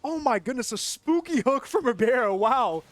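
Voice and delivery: loud, high, laughing outburst; excited amazement. Real, not acted. Caster reacting to a spooky hook, loud and thrilled. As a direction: crow in amazed delight at the play.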